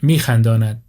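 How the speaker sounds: ordinary delivery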